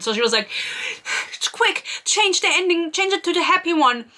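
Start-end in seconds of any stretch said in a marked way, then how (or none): 1.5-4.1 higher-pitched hurried urging; urgent mock panic. Retelling her order to change the ending, mock urgent. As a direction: act out a frantic last-minute order.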